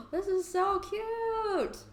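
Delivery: high, slow squeal of delight; gushing. Acted. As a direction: gasp and coo over something adorable. Character gushes with a gasp.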